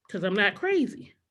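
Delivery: usual speaking voice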